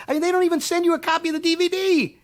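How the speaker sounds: rising exasperation; exasperated. Real, not acted. Griping that studios send no copy. Furious complaint, higher.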